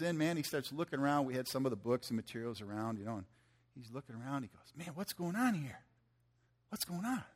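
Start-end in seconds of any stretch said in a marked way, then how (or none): 4.8-7.2 higher, eager questions tumbling out; excited young man. Retold or imitated. Young guy asking the pastor what's happening, excitedly. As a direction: play the eager kid bursting with curiosity. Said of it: "He's just all excited".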